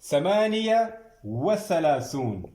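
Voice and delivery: teacher calling the next number; called out number. Shown as a demonstration. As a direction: announce the number, then dictate slowly. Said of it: "dictating numbers to class".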